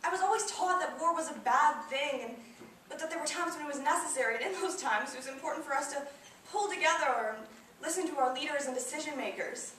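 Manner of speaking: put-on pleading to justify yourself to your child; pained insistence. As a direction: insist on what you were always taught. Grieving mother defends what she taught.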